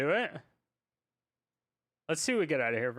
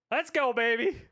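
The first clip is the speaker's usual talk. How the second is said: higher, louder hype shout; louder cheer; 6 semitones higher, 4 dB louder than usual. Real, not acted. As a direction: push it up, pumped. Celebrating win, louder.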